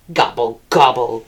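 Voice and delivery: low, grumpy turkey gobble; grumbling gobble. A narrator's reading. Turkey sulking after Cow sees through him, low grumbled gobble. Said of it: "grumbled Turkey".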